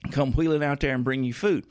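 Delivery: ordinary talk, nothing marked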